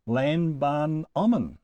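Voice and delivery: lambanomon sounded out slowly in separate parts; slow, syllable by syllable. Shown as a demonstration. Teacher introducing the third person plural, lambanomon slowly sounded out. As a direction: draw out lambanomon piece by piece, lam, ban, omon. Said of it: "this time in the third person plural".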